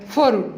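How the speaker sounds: فرج with f replacing the initial b of برج; sound substitution. Shown as a demonstration. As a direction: say فرج, swapping b for f. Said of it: "ده اسمه إبدال".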